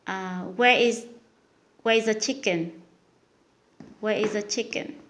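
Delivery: usual speaking voice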